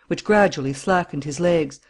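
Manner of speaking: usual speaking voice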